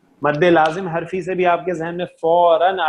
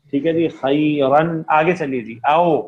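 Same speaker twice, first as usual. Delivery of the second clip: usual speaking voice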